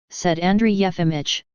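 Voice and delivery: ordinary delivery, nothing marked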